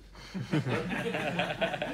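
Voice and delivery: a real laugh, open and warm; hearty laugh. Real, not acted. After joking that crowds throw tomatoes, a real laugh. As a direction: laugh out loud at your own joke.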